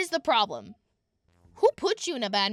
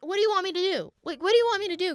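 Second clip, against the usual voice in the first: high, loud mock exasperation; exasperated retort; 9 semitones higher, 6 dB louder, much faster than usual. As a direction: push it high and insistent, then repeat louder. Exasperated retort to demanding customer.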